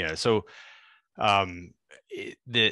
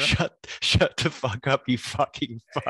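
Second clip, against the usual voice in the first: loud, slow, raised-pitch furious mock rant; Shelley angry; 5 semitones higher, 4 dB louder, slower than usual. Imitating Shelley when she gets angry, loud furious cursing. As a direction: spit it out like an enraged coworker cursing. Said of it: "When she gets angry".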